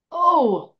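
loud, high, overdone exclamation; unnecessarily loud. A narrator's reading. Student reacting to the professor's spell, unnecessarily loudly. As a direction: overplay a sudden loud reaction. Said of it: "rather unnecessarily loudly".

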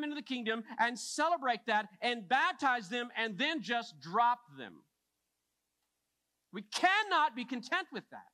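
high, insistent pulpit delivery; forceful preaching. Real, not acted. Preacher challenging his church on discipling new believers, forceful preaching. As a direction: preach it hard, punching the key words.

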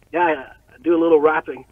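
ordinary delivery

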